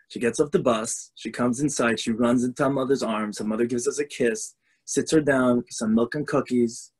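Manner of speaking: ordinary talk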